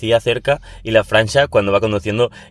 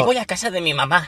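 put-on higher, childish goody-goody tone; lightly voiced good kid; mock good boy voice; 8 semitones higher than usual. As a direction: play the innocent little boy. Mimicking a goody-goody robot driver.

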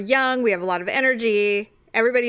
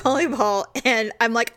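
ordinary talk, nothing marked

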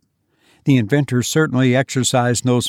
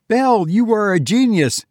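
much higher, amazed exclamation; gasped praise; 9 semitones higher than usual. Acted. Clinton gasping praise to Belle after the door opens. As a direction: gasp it out in disbelief and relief. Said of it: "gasped Clinton".

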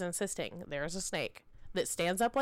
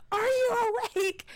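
high, loud, tearful cry; panicked plea; 14 semitones higher, 5 dB louder than usual. Retold or imitated. Mother shaking her sleepwalking daughter, high frightened cry. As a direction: shake the question out of her in fear.

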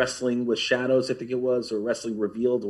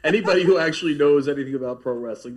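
laughing; 5 semitones higher, 5 dB louder than usual. Real, not acted. Laughs at the fan question.

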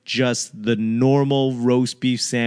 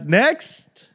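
high, rising call to the line; impatient high-pitched callout; brusque counter worker; 10 semitones higher than usual. Retold or imitated. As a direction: imitate a server calling out for the next customer. Imitating Popeyes worker calling customers.

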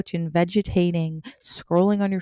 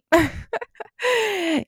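high laugh; 13 semitones higher than usual. Real, not acted. Laughing after the repetition.

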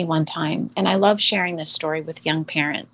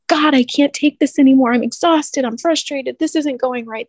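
high, loud exasperated plea, raised well above normal; exasperated cry to God; 6 semitones higher, 3 dB louder than usual. Retold or imitated. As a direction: vent to God, worn out and fed up. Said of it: "I just was like".

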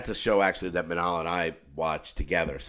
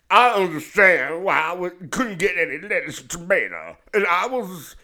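silly put-on voice; 10 semitones higher, 5 dB louder than usual. Customer grumbling alone in the car, silly high voice. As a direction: complain in a much higher silly voice. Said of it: "I just keep doing the voice".

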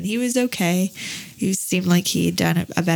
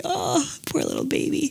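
higher, soft cooing pity; tender pity; 9 semitones higher, 4 dB quieter than usual. Retold or imitated. Mom seeing George's puffy eyes, cooing softly. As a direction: coo over a crying child. Pitying son in the photo.

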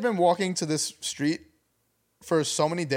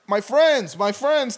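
higher, louder imitation of Miguel calling out; calling my friends; 8 semitones higher, 5 dB louder, slower than usual. Miguel chasing them up the block, calling higher and louder. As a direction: mimic a guy hurrying up the block calling my friends.

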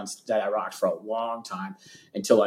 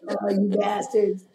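mock curse; 9 semitones higher, slower than usual. Friends cursing, retold.

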